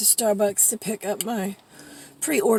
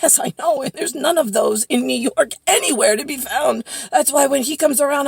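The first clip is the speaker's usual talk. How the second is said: loud, insistent and lifted; worked-up retold reply; emphatic agreement; 4 semitones higher, 6 dB louder than usual. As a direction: agree wholeheartedly, pushing each point.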